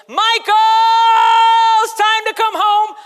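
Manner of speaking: loud, high call from the window. Retold or imitated. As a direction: call him home across the hill. Parent calling from the window.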